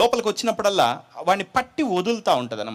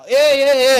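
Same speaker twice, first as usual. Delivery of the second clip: loud, high taunting demon yells; mocking demon cries; 9 semitones higher, 10 dB louder, much slower than usual. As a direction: jeer back like a demon.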